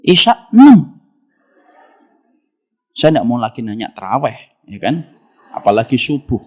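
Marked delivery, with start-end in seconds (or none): 0.1-1.0 loud proud answer. Retold or imitated. Young man proudly answering the preacher, loud and high.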